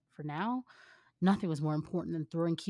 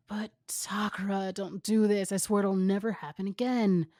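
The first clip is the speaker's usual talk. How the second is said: desperate plea; 3 semitones higher, 6 dB louder than usual. Acted. Boyfriend final plea.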